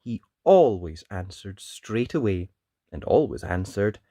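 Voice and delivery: put-on lively read-aloud voice for children; animated picture-book narration; storybook reading. A narrator's reading. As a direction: tell it like bedtime story time.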